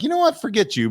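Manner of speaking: high-pitched, huffy comeback; exaggerated offended brush-off; mock indignant retort. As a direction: act out the retort you held back, voice jumping up. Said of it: "respond back and be like".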